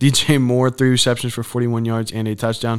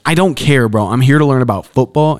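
loud exasperated rant; 4 semitones higher, 10 dB louder than usual. Real, not acted. Ranting about [name] coverage.

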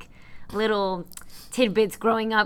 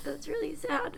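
crying; 7 semitones higher, 8 dB quieter than usual. Real, not acted. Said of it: "Sorry for the crying".

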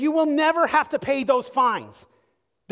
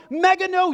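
much higher and louder "Mega no" burst; emphatic; 9 semitones higher, 6 dB louder than usual. Shown as a demonstration. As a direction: shout out "Mega no" from the pulpit. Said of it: "emphatic statement".

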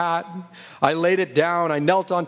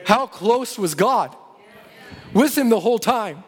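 higher, ringing sermon climax; raised-pitch, fervent preacher's build; impassioned preaching; 6 semitones higher than usual. Real, not acted. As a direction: drive each phrase home to the congregation.

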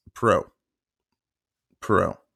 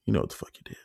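softer, lower repeat of the threat; 3 semitones lower, 5 dB quieter, much faster than usual. Retold or imitated. To the roommate, repeating the threat: low and soft. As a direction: let it drop low and cold. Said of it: "That's all I said to him".

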